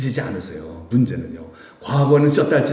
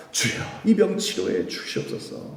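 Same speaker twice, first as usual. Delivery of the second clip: whispered prayer; 8 semitones higher, 5 dB quieter than usual. Retold or imitated. Paul's final prayer retold.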